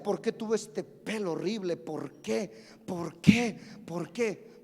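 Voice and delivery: low, mostly soft mock-anguished plea; retold lament. Preacher re-enacting his private complaint about his hair, low lament. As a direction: re-enact a youngster's despairing question, pitched low.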